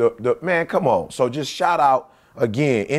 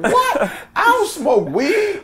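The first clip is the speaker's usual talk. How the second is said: very high-pitched, drawn-out disbelief; hammed-up scandalized protest; mock shock; slower than usual. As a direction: squeal an indignant denial for laughs. Replays his own shocked denial.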